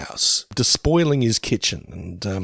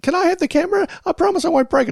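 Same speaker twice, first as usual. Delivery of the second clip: put-on much higher pleading kid voice; child asking; much faster than usual. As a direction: beg like a little kid. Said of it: "one of them had".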